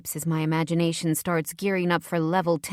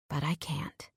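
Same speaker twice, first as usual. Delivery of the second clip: breathy confession; 2 semitones lower, 8 dB quieter than usual. A narrator's reading. Narrator's inner confession.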